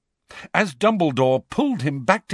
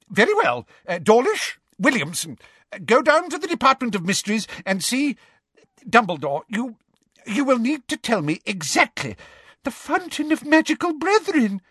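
flustered; 11 semitones higher than usual. Acted. Flustered Fudge giving orders.

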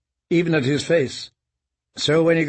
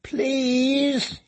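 much higher squeaky plea; weak squeak; 11 semitones higher than usual. Acted. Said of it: "he squeaked, his voice thin and weak".

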